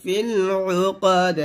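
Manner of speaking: slow, drawn-out tajweed chant; slow Quran recitation voice; Quranic recitation. Shown as a demonstration. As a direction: recite it slowly and melodically.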